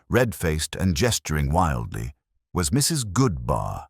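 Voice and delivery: usual speaking voice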